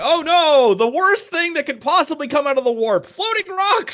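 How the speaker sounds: high, squealing mock panic; mock horror. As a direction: gasp it out in fake terror. Mock horror at floating rocks.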